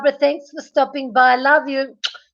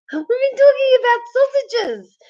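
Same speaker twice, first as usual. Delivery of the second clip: laughing; 11 semitones higher than usual. Real, not acted. Laughing while explaining.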